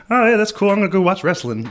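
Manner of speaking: high, loud dismissive Kenny impression; mock Kenny. Friend brushing off praise, high and loud. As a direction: toss it off as uninterested Kenny. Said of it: "he was like".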